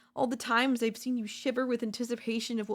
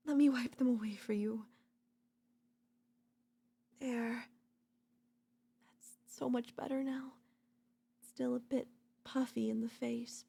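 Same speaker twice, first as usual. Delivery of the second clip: soft soothing whisper while wiping tears; tender whisper; 4 dB quieter than usual. Acted. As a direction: hush them gently, breathy close.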